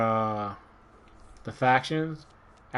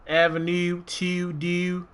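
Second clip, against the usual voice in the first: put-on Baltimore accent; 6 semitones higher, much slower than usual. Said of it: "when I'm from Maryland, Baltimore, it's his own thing".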